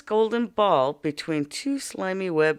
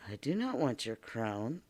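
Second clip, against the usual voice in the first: frog voice; 5 semitones lower, 10 dB quieter than usual. Acted. Frog answers princess.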